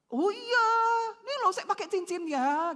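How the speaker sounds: mock someone showing off the ring; mock proud. Someone showing off a wedding ring, mock proud.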